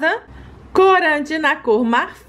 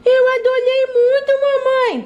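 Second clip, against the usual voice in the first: put-on little kid voice; 11 semitones higher than usual. Child voice in family skit.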